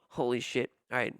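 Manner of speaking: ordinary talk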